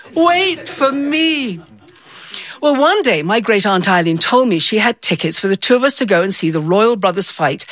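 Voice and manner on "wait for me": slower, dreamier plea. Retold or imitated. Repeated plea, second take.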